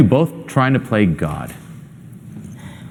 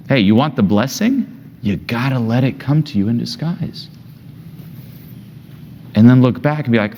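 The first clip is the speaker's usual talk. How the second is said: ordinary talk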